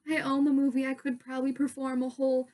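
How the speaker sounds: loud, tumbling fan gush, re-enacted; starstruck babbling. Fan begging two stars for a picture, starstruck. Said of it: "I was so starstruck".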